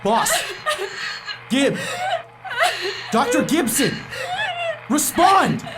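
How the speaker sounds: shouting through tears, high; commander's desperate sobbing command; crying shout. Acted. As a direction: order him to answer, breaking. Commander shouting at missing man.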